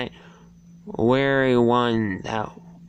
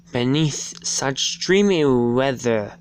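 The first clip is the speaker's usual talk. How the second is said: ordinary delivery, nothing marked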